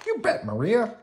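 high, loud, keen agreement; Bert. A narrator's reading. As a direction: agree eagerly, pitch jumping up. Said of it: "said Bert".

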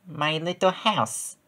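soft, slow little mouse; a quiet little mouse answering. A narrator's reading. The mouse answers the little goose softly and slowly. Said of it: "said Mouse".

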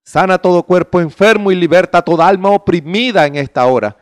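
ordinary delivery

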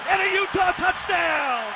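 high, stretched-out celebratory shouts; exultant touchdown call. Real, not acted. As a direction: let each call ring out long.

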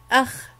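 أخ said as "akh", the خ closed off with no vowel after it; teacher sounding out "akh"; syllable demo. Shown as a demonstration. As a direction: end أخ on a bare خ. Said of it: "وتقرأ".

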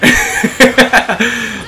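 high burst of laughing; excited laughter. Real, not acted. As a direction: cackle through the final moments. Laughs during final attack.

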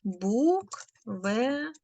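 Буквений said very slowly, syllable by syllable; slow, deliberate answer word; slow demo word. Shown as a demonstration. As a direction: stretch Буквений out so pupils hear the single н. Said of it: "він який буде?".

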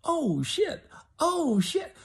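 high, hushed mock alarm of a kid at the window; shocked teen. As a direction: gasp it like a kid watching trouble. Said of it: "looking out the window going".

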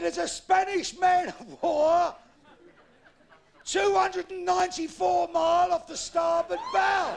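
high, slow, very loud hail; drawn-out long-distance yell; lookout's shout. Acted. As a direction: bellow it down from the crow's nest.

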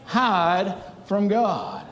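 fervent pulpit preaching with a lift. Acted. Pastor preaching to the lost in the pews. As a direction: preach it out to the whole church.

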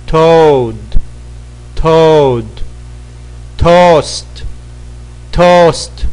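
crisp classroom drill; pronunciation drill. Shown as a demonstration. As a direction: say each word like a teacher for the class to repeat. Drill continues.

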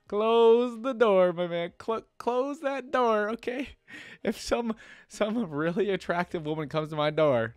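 loud, high, drawn-out playful pleading; mock alarmed warning. Reader yelling at the man to close the door, mock alarm. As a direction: holler a joking warning at the story's hero.

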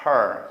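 "her" as har with a held English r; drawn-out "her", r clearly sounded; r sound added. Shown as a demonstration. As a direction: curl a long r into "her". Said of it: "알발음부터 집어넣읍시다".

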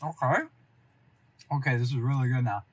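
speaking between bites of pizza; talking while chewing. Real, not acted. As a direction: mouth partly full of food. Seen 2: Lips pressed and working between words, pizza held at mouth.

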